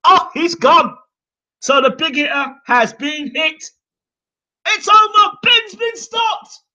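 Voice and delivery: high, loud excited commentator; commentator's call. Retold or imitated. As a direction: call the knockout like live TV commentary. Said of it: "He goes".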